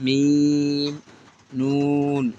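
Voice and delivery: letter names stretched long, one by one; slow, stretched Arabic letter names; drawn-out recitation. Shown as a demonstration. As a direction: draw out each letter name like a Quran teacher. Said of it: "এই লাইনটা আমি দুইবার পড়ছি".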